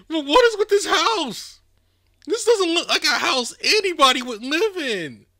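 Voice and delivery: high, loud disbelief at the house; incredulous outburst. Real, not acted. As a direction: exclaim in shocked disbelief. Shocked reaction to the house.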